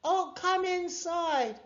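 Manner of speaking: very high, slow call; high, stretched holler; crying out. A narrator's reading. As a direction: call it through the window. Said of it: "cried Mags".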